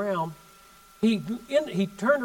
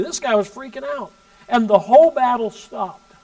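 ordinary delivery

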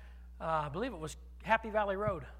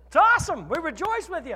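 high, overbright polite praise; congratulating a neighbour on his new truck, put-on cheer; forced enthusiasm; 7 semitones higher, 8 dB louder than usual. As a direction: gush politely to cover confusion. Feigned cheer over neighbour's truck.

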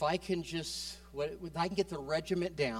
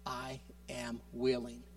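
softer and slower, God's gentle answer; soft, slow reassurance; 3 semitones lower, 4 dB quieter, slower than usual. Pretend. God answering a crying believer, slow and soft. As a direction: let it land slowly and softly. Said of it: "a voice that says".

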